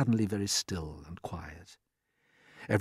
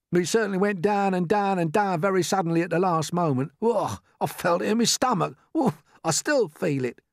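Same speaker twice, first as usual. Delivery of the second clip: higher, complaining voice; gloomy earthworm; 8 semitones higher, 4 dB louder than usual. A narrator's reading. Earthworm grumbling about the fall, queasy complaint.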